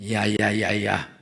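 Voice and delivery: low, soft scoff at the wife; mock husband's low, weary-sounding brush-off; dismissive scoffing. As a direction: wave the wife off under the voice.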